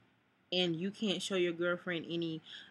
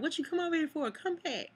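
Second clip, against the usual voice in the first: coworker calling her back; 10 semitones higher than usual. Retold or imitated. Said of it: "she was like".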